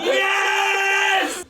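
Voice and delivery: high loud cheer; excited shout. Real, not acted. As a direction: cheer along at full voice. Joins the slogan shout.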